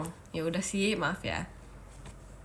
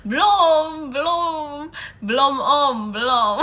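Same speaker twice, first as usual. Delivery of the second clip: whiny mock reply; 3 semitones higher, 6 dB louder, slower than usual. Playful whiny reply to teasing.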